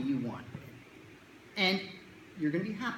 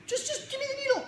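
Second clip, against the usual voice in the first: put-on much higher impatient kid; pushy kid. Kid insisting to the doctor, high impatient nag. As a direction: nag it like a restless child.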